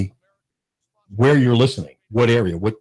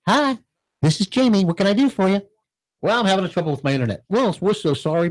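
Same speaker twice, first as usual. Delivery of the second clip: high, sugary Southern customer service voice; sweet Southern phone agent; 6 semitones higher than usual. Retold or imitated. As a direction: chirp it like an overly friendly phone rep. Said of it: "You sound like Tootsie".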